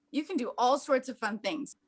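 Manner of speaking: normal talking voice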